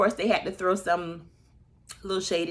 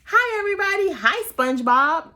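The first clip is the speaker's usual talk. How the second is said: high and loud, sassy; loud mocking hello, much higher; fiery LaToya greeting; 12 semitones higher, 8 dB louder than usual. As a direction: burst on stage shouting hi. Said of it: "She's a spitfire".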